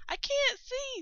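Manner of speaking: a tiny snail panicking in the dark; alarmed snail. A narrator's reading. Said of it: "said the snail".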